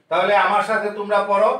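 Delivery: normal talking voice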